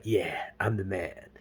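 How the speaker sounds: low, slow, laid-back cool guy. Retold or imitated. James drinking his liquor, mock cool guy. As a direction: drop it soft and self-satisfied. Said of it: "he's doing his cool guy stuff".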